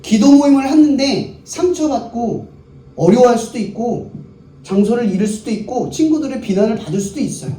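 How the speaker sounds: normal talking voice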